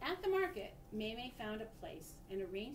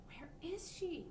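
slow, higher worried question; worried wondering; 8 semitones higher, slower than usual. A narrator's reading. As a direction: ask it slowly, fretting. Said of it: "May May wondered".

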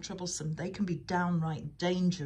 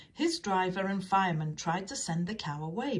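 plain reading voice

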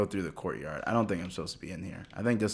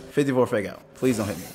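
ordinary talk

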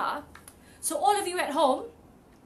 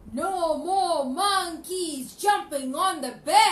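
doctor; 2 semitones higher, 4 dB louder, slower than usual. Acted. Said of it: "and the doctor said".

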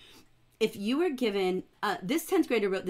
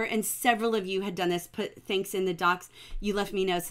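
normal talking voice

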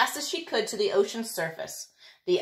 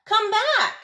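high, loud call after someone leaving; 12 semitones higher, 5 dB louder than usual. Acted. Tooth Fairy calling after Gertie: high loud shout. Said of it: "she called out".

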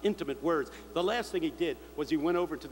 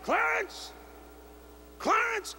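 high, ringing call to the dead man; a preacher calling his dead friend by name at the casket, high ringing shout; preacher's yell; much slower than usual. Retold or imitated. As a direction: yell the name with full authority. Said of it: "He just yelled".

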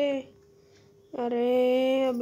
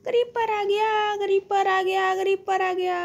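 much higher, louder frantic cry; panicked alarm; 9 semitones higher, 5 dB louder than usual. Real, not acted. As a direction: yell it while fleeing in panic. Creeper appears, panicked running.

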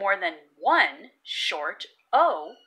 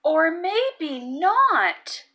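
teasing twist; 6 semitones higher, much slower than usual. Acted. Twist ending of story.

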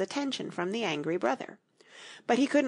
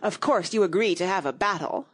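usual speaking voice

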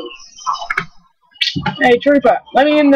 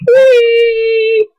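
gleeful whee; 6 semitones higher, 3 dB louder than usual. Real, not acted. Gleeful flying cry.

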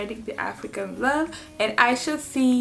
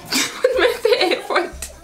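laughing; 10 semitones higher, 3 dB louder than usual. Real, not acted. Laughing at own mistake.

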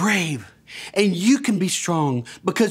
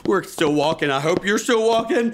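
ordinary delivery

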